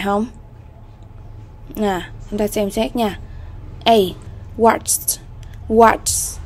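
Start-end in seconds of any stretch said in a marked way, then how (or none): 4.6-6.4 "watched" ending in a crisp T; "watched" modelled as one syllable, watch-t; final T sound. Shown as a demonstration. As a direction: pronounce the -ed of "watched" as a T. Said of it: "Đây là âm T".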